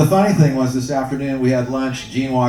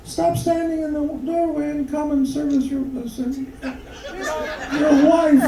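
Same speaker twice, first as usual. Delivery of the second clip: very high falsetto wife; coaxing wife, pitched way up; wife's voice. Acted. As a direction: put on a mock woman's falsetto.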